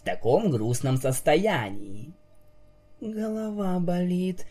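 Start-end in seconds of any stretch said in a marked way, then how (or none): 3.0-4.5 quiet mumble. Acted. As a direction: mumble it, head aching. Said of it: "тихо пробормотала".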